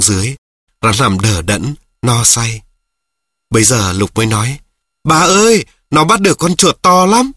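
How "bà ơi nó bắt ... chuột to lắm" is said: higher, louder excited call; boy calling grandma excitedly. Acted. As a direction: call it out to grandma in the next room. Lục calls out to grandma.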